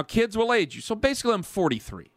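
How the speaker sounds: normal talking voice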